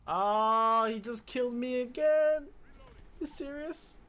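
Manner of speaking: loud, high, disbelieving shout; real outraged yell. Real, not acted. Killed again by the same guy, loud outraged disbelief. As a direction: yell it at the screen.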